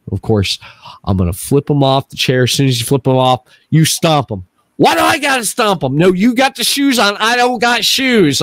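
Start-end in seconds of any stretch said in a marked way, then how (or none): 4.8-6.0 high-pitched mock complaint; imitated protest. Imitating wife refusing to stomp, high protest. Voicing wife's protest in spider story. 6.0-8.4 high-pitched retold squabble; insistent arguing. Arguing with wife over shoes, high and insistent.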